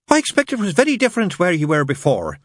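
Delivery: high, eager nun voice; chatty nun. A narrator's reading. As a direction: make eager small talk up high. Nun keeps conversation going.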